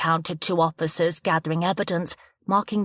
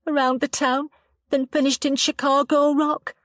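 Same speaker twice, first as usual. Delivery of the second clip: put-on upset young woman, lightly higher; 7 semitones higher than usual. A narrator's reading. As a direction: shaken girl giving a statement. Chloe's voice.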